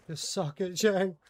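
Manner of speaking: high, loud gloating jab; loud taunt. Real, not acted. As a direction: crow it out at the opponent. Taunting opponent.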